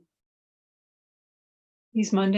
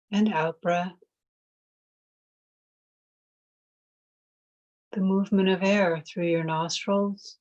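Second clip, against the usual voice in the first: guided meditation. Real, not acted. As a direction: ease them into noticing the breath. Leads a mindfulness meditation.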